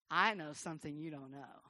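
low, secretive smugness; knowing smirk. Retold or imitated. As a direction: say it slyly through a smirk. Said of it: "A smirk is a smile that says".

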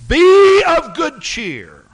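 high, loud and slow call; voice of Jesus. Acted. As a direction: ring it out slowly across the water. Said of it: "and the voice said".

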